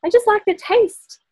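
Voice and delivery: high, light and matter-of-fact; lightly voiced friend; friend's reply. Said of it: "she's like".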